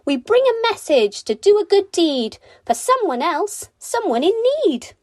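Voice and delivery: sing-song bird message, tweeted high; tweeting birds. A narrator's reading. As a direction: pipe it like a tiny bird.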